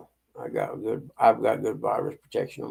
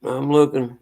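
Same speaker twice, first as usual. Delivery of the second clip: ordinary talk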